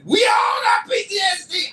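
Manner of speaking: high, loud shouted street tough; gangster operator. Retold or imitated. Hotline operator shouting at the caller, rowdy street tough. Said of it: "gangster customer service operator".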